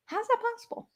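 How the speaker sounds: high, incredulous squeak; a playful falsetto of disbelief; mock disbelief. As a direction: act shocked nobody's come after you. Mock disbelief about no hate.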